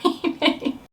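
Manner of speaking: laughing self-mockingly. Real, not acted. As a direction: giggle through it. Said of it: "such a self-absorbed video".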